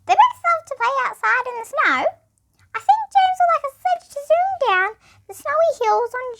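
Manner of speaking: high mouse voice; high squeaky mouse chatter. Acted. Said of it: "said my Mouse".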